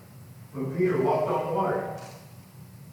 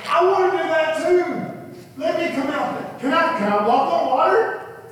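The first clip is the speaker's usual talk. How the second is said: much higher, eager and loud; excited Peter; 12 semitones higher, 6 dB louder than usual. Acted. Peter begging Jesus to let him walk, high excited voice. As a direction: bounce with eager excitement like a kid. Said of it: "he's getting all excited, he's fired up".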